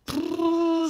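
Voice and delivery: lip-trilled prrr like a toy car; soft imitated engine buzz; toy motor noise. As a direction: buzz your lips like a playing kid. Boy playing with toy.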